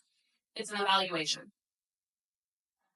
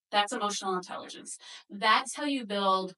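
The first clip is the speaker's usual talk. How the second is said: normal talking voice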